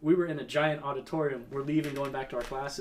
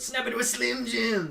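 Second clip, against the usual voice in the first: high, gravelly-free raspy wrestler catchphrase growl, pitched up; Macho Man impression; 9 semitones higher, 4 dB louder than usual. Telling friends about the mascot, imitating the Slim Jim wrestler. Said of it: "that guy that did the Slim Jim commercials".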